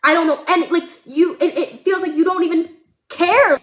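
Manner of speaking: high, loud, stumbling desperate accusations; screaming outburst. Acted. Daughter accusing her mother of not knowing her, hysterical. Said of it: "The way that I'm screaming at you".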